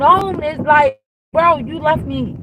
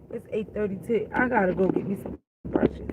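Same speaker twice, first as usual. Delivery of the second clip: whispered aside; 6 semitones lower, 10 dB quieter than usual. Real, not acted. Aside muttered to herself.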